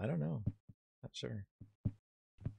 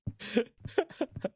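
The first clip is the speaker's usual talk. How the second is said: high giggle; 12 semitones higher than usual. Real, not acted. Laugh alone.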